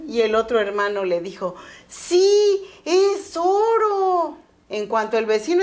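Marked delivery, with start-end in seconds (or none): 1.9-4.4 much higher, slow, teasing mock reply; sarcastic brother, higher and louder. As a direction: stretch it out to taunt him. Brother mocks the gold joke.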